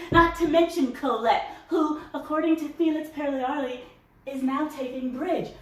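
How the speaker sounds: high, drawn-out, scornful delivery; haughty disdain. Acted. Mother complaining of Colette to son, haughty disdain. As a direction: stretch it out with lofty distaste.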